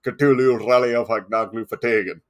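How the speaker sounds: put-on old sci-fi announcer. Repeats alien phrase.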